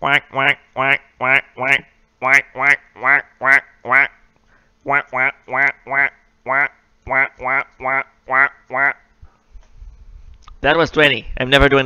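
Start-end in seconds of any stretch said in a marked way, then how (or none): 0.0-9.0 steady string of mock duck quacks; duck quacking. Streamer quacking twenty times for chat, rhythmic mock duck quacks. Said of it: "Can I crack twenty times?".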